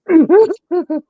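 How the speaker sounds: shrieking burst of laughter; loud high laugh. Real, not acted. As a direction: explode into laughter. Loud laugh after missing talk.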